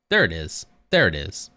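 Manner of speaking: usual speaking voice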